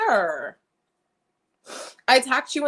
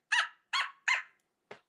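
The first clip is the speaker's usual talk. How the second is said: excited squeal; 8 dB quieter, much faster than usual. Real, not acted. Squealing over denim collection.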